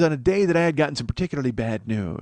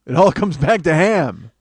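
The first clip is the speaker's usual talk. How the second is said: triumphant; 5 semitones higher, 8 dB louder, much slower than usual. Real, not acted. Triumphant punchline callback.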